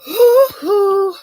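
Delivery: two squealing high, loud oohs; delighted diva squeals. Acted. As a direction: squeal twice as if it tastes divine. Diva savouring the juice.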